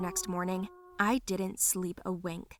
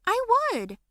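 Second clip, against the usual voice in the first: very high, louder cry; emotional insistence; 14 semitones higher, 5 dB louder than usual. Acted. Daughter telling dad she'd go, upset insistence. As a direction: blurt it out, pitched way up.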